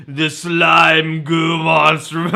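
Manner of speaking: slow, loud slime monster voice. Retold or imitated. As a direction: drag it out like a gooey monster. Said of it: "Wait, that's not what he sounds like".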